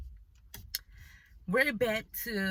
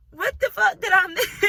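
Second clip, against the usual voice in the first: much higher and louder, disbelieving shriek; shocked outburst; 12 semitones higher, 9 dB louder than usual. Retold or imitated. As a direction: blurt it out in total shock. Shocked at his ugly face.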